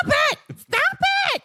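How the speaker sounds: mock weepy squeak. Overwhelmed by kindness, mock crying.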